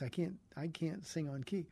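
ordinary talk

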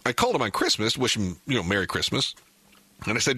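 ordinary delivery

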